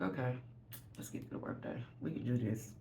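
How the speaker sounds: hushed, breathy "we can do this" to herself; whispered self pep talk. Retold or imitated. Tired woman whispering encouragement to herself before work. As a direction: talk yourself into the workday under your breath.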